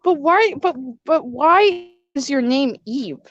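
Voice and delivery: ordinary talk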